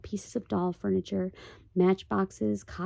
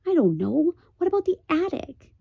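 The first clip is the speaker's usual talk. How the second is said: put-on higher, louder young boy; boy's voice; 8 semitones higher, 5 dB louder than usual. The boy suggests the attic to Pod, high and eager.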